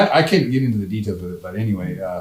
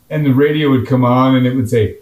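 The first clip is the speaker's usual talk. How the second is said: normal talking voice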